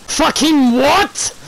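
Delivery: slow, high, loud disbelief; furious at a bad reward; outraged. Real, not acted. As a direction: drag out the outrage.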